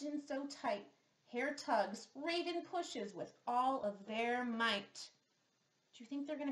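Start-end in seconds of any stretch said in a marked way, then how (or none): none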